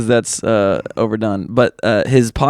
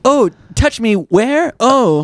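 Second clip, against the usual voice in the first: high, breathy come-on; sultry hologram voice; 10 semitones higher than usual. Pretend. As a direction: tease like a flirty hologram.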